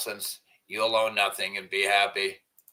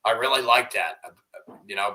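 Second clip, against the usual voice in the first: usual speaking voice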